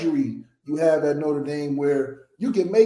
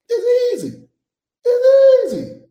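emphatic squeal; 7 dB louder than usual. Real, not acted. Host hyping how easy the adjustment is, emphatic squeal. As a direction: stretch it high, louder the second time.

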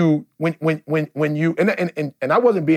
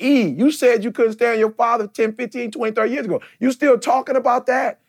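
higher, incredulous needling voice; mock listener; 7 semitones higher than usual. Imagined friend needling the pastor, higher mock disbelief. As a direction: tease like a friend calling him out. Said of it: "y'all like".

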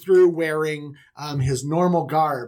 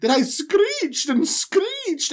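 high, slow drawn-out mock screech; 12 semitones higher, much slower than usual. Potiphar's wife telling servants she screeched, high and slow. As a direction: stretch the word like a prissy lady. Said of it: "And she's like".

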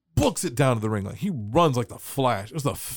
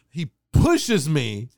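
normal talking voice

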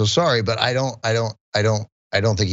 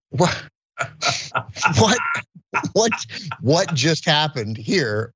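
baffled disbelief; 6 semitones higher, slower than usual. Retold or imitated. Baffled at mom's accusation.

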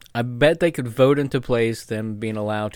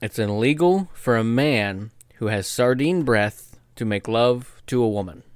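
ordinary talk

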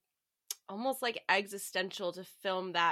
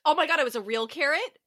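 shocked question; 4 semitones higher, 4 dB louder, faster than usual. Real, not acted. Shocked at the carrot story.

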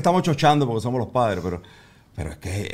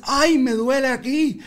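much higher, slow mock-hurt little girl; whiny toddler voice; 12 semitones higher, 4 dB louder, slower than usual. Dad mimicking his daughter dodging orders, slow fake whine. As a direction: stretch it out like a child faking an ouch.

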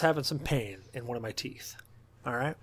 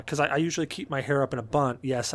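normal talking voice

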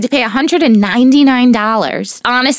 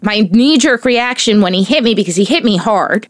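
ordinary delivery

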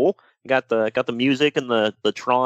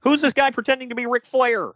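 incredulous; 11 semitones higher than usual. Retold or imitated. Mock viewer reaction, quoted.

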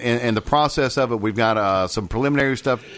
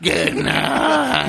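higher, wailing good night; high mock sign-off; 11 semitones higher than usual. Shown as a demonstration. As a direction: overdo the goodbye, wailing. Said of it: "You stole it from me".

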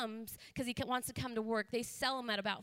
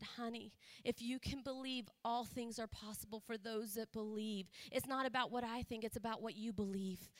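soft, tender encouragement; hushed motherly comfort, retold; retold gentle reassurance; 6 dB quieter than usual. Retold mother reassuring crying daughter.

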